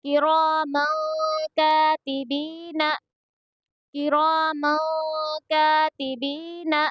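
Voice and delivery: "किरामन कातिबीना" recited slowly, stretching रा, का and बी; stretched long vowels. Shown as a demonstration. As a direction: chant "किरामन कातिबीना" with the N hidden in a held nasal. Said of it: "इसको थोड़ा खींच कर पढ़ेंगे".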